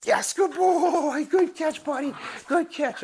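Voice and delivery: very high, slow, laughing praise; delighted praise for the catch; puppy praise. Real, not acted.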